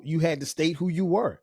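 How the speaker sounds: normal talking voice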